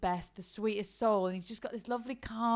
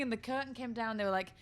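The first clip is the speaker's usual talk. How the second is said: ordinary delivery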